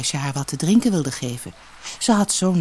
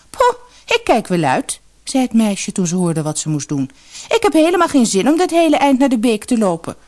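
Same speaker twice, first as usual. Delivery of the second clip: high, louder scornful whine; sulky refusal; 6 semitones higher, 5 dB louder than usual. Acted. Lazy elder sister to mother, loud scornful refusal. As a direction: brush it off, can't be bothered.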